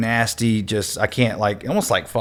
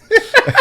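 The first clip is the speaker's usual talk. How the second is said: short high burst of laughter; cracking up; 7 dB louder than usual. Real, not acted. Laughing at imitation.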